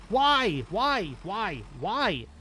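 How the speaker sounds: high, pleading repeated why; exasperated whine. Real, not acted. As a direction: whine it over and over. Repeated baffled whining question.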